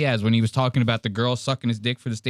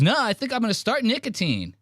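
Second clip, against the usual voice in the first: high, whiny mock plea; nervous nerd friend; 10 semitones higher, faster than usual. Mocking the nerd friend begging to leave, high and whiny. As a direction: whimper like the friend bailing on the trip. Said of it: "He goes".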